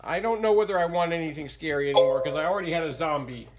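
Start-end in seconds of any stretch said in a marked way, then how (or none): none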